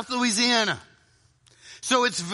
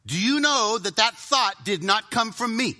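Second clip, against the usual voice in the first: ordinary delivery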